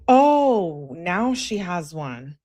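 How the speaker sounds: sarcastic, stretched-out commentary; mock realization. Real, not acted. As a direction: react with exaggerated, drawn-out mock surprise. Reacting to the sudden outfit claim.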